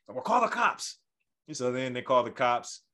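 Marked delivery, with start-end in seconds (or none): 0.2-1.0 high-pitched mock-angry challenge; hammed-up defiance, pitched up; irate dare. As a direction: imitate a furious customer calling their bluff. Irate customer dares the staff.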